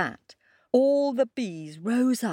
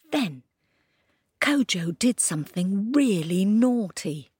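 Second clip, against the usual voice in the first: plain reading voice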